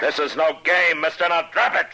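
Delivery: loud, high barked order; commanding shout. Acted. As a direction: make him drop the gun now. Marshal ordering the gun dropped.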